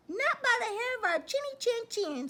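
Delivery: high, squeaky refusal; squealing little pig. Acted. Pig refusing the wolf through the door, squealing high. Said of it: "squealed the two little pigs".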